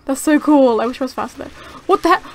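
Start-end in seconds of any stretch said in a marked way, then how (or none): 1.9-2.2 real startled shouts, high and much louder; panicked yelling. Real, not acted. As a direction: yelp in sudden alarm. Startled mishap while playing game.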